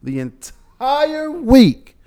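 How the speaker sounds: high, slow, drawn-out disbelief; exasperated. Real, not acted. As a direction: stretch it out in disbelief. Exasperated rant about complainers.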